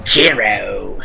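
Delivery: low, drawn-out announcer call; ring announcer voice. Retold or imitated. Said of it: "you have to say it like the ring announcer said it".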